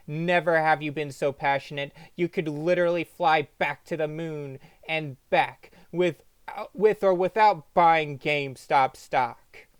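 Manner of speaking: ordinary delivery, nothing marked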